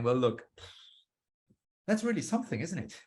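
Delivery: relaxed interviewer leaning back, voice swooping up high on "something"; laid-back casual. Shown as a demonstration. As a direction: loosen up and sound like an off-the-record chat. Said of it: "change your attitude as an interviewer, like, leaning back".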